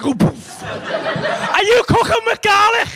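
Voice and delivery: shrill high accusing wife voice, drawn out; hammed-up high voice of the furious pregnant wife; mock wife. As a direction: ham up the pregnant wife's outrage. Wife storming in, voiced by comic.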